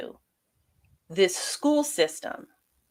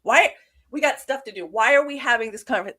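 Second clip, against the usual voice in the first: dismissive; 6 semitones higher, 5 dB louder than usual. Retold or imitated. Acting out her own dismissive reaction.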